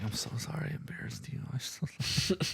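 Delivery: soft, whispery mock apology; embarrassed apology. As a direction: murmur a mortified sorry as a child. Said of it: "It was like that embarrassment".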